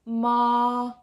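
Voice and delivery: monotone "Ma" with no movement in pitch; first tone. Shown as a demonstration. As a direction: hold "Ma" straight across, very even. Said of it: "very even".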